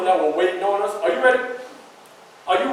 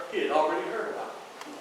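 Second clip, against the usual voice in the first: normal talking voice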